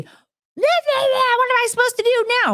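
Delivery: very high, rushed mocking squawk; mock panicked squawk. Imitating manager reacting to transfer notice, mock panicked squawk. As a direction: squeal it fast to ridicule a flustered boss.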